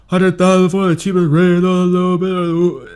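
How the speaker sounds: high, loud, slurred rapper voice; mocking slurred mumble rap; mumbling Future impression. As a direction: garble it again like a lazy rapper. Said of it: "Are you deaf?".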